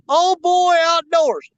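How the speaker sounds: loud, high, drawn-out call; loud shout-out. Real, not acted. Angler plugging a friend's premiere, calling it out loudly. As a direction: boom out the name like a slow announcement. Calls out a channel name.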